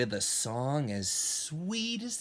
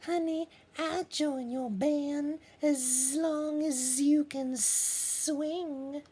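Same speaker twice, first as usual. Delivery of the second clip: sassy Bee. Acted. Bee Holiday teasing Rooster about swing, high and sassy. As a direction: croon it like a jazz diva. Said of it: "Bee said".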